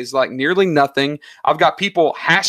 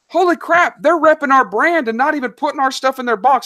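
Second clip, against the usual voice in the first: amazed; 10 semitones higher than usual. Retold or imitated. Business owner retelling the subscription box surprise, excited. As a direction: blurt it out astonished. Amazed by free brand promotion.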